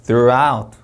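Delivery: THROUGHOUT, loud weight at the end; final-syllable stress. Shown as a demonstration. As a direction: hit the last syllable of THROUGHOUT. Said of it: "마지막에 강세 들어가고요".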